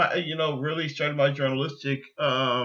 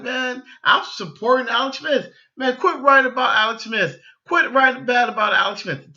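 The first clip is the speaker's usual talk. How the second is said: higher and louder, pleading fan; heated fan plea; 8 semitones higher, 7 dB louder than usual. Retold or imitated. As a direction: defend your guy, fired up and loud. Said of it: "I'm over here like".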